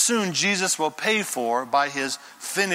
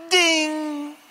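halo ding; 9 semitones higher than usual. Acted. Halo sound effect.

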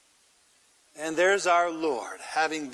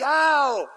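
cry of pain; 7 semitones higher, 4 dB louder than usual. Acted. Pain while being nailed.

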